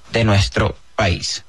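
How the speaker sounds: ordinary talk, nothing marked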